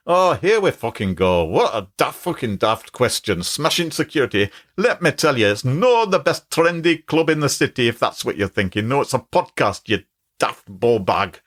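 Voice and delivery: irreverent mock-Glaswegian scolding; put-on Scottish accent. Chatbot answering a silly question, in cheeky Scottish dialect. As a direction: do your best sweary Glasgow accent. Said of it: "I'll do my best, uh".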